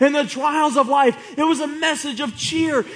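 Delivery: normal talking voice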